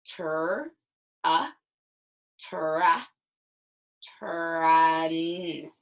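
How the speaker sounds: "tur, tra, trun, trunk" built up slowly, one sound at a time. Shown as a demonstration. Teacher blends the sounds of "trunk" aloud for the class. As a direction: build the word "trunk" from its pieces, steadily. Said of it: "Let's start by blending".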